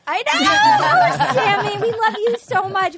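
high, drawn-out fond cooing; affectionate gushing. Real, not acted. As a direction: gush over a dear friend. Hosts gush fondly over Sammy.

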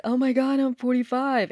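normal talking voice

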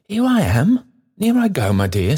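put-on quick bouncing old porter, pitched high and loud; trotting speech. Trotty guiding Uncle Will home, trotting along in his talk. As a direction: hurry the stranger along, jaunty.

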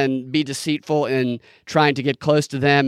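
ordinary talk

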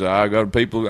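low, abandoned attempt at a cowboy star; badly faked movie-star impression; John Wayne. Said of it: "when John Wayne gets upset".

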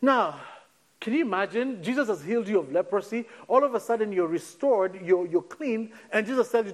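ordinary talk

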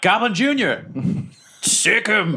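high, carrying attack command; calling out mid-sprint. Acted. Player sics pet goblin on the wizard, high-pitched call. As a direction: call it high, as if to a dog ahead. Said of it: "I'm in full sprint, and I'm like".

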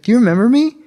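high, loud anxious question; nervous eagerness. Retold or imitated. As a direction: ask too eagerly, nerves showing. Nervously asking the girl.

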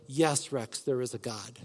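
soft assurance. Retold or imitated. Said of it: "I get a".

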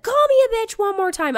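very high shrill threat; hammed-up warning; mock threat. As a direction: dare her, imitated. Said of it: "She's like".

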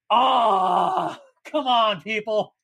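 high exasperated plea. Real, not acted. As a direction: throw up your hands at them. Reacting to no headlamps.

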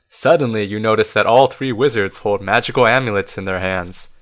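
ordinary delivery

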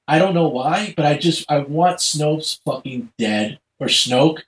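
ordinary delivery

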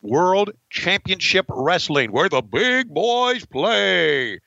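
slow, stretched-out promo read; drawn-out wrestling announcer; slogan announcer. Acted. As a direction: boom out the slogan word by word. Reads logo slogan like announcer.

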